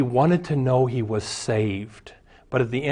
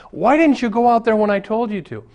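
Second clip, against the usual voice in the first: much higher and louder, scolding; angry wife; 9 semitones higher, 4 dB louder than usual. Acted. Wife scolding the lifeguard for waiting, angry and high-pitched. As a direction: tear into him like a furious wife. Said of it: "the wife is so mad".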